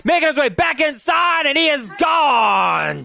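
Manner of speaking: building to a shouted touchdown; touchdown call. Real, not acted. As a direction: yell it home as he breaks free. Touchdown run called live.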